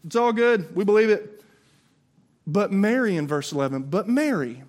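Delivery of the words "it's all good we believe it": casual confidence. Retold or imitated. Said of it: "Peter and John are like".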